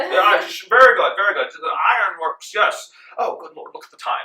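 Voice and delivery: a bumbling courteous gent; large red-faced financier. Acted. Financier fusses about the time.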